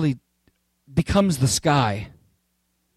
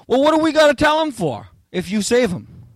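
questioning disciple; 9 semitones higher than usual. Acted. Disciple questions Jesus, acted.

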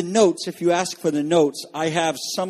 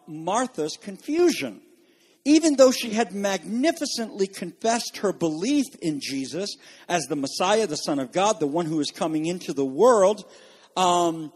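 ordinary talk, nothing marked

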